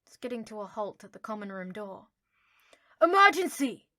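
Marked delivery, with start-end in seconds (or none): 3.0-3.9 high, loud urgent yell. Acted. Harry yelling at the portrait guard. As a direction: shout it at the portrait. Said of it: "he yelled at the fat lady".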